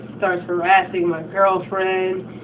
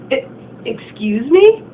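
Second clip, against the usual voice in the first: stuttered; 5 semitones higher, 6 dB louder, slower than usual. Retold or imitated. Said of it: "he stuttered".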